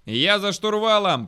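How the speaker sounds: loud, high, slow yell; bellowed announcement. A narrator's reading. Captain at the controls to his crew, bellowing. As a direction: holler that you're taking over. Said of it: "проорал Петровский".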